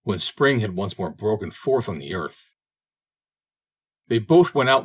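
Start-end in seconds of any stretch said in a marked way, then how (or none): none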